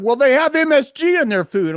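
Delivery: high, loud mocking quote; mocking the friend. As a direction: mimic him as whiny and smug. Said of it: "He's like".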